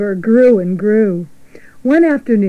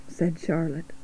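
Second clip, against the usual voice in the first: ordinary delivery, nothing marked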